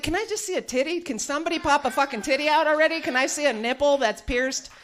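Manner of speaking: eager mock plea; mock pleading. Her inner plea at audience.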